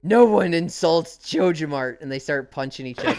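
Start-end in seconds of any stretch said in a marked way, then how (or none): 0.0-2.0 loud, slow mock outrage; indignant villain. Manager defending JojaMart before the punches, mock indignation. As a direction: play an insulted manager squaring up. Game villain provoked into fight.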